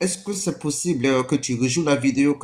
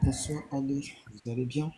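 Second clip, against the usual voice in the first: faint, distant caller voice; barely audible call-in voice; on speakerphone; 10 dB quieter than usual. Real, not acted. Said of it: "faut élever ta voix".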